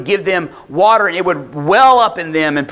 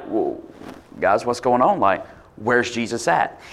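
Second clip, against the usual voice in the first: lower, softer demanding question; hushed Pharisees; 7 semitones lower, 6 dB quieter than usual. Retold or imitated. Pharisees asking officers where Jesus is, low and hushed. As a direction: drop low and quiet as the annoyed officials ask.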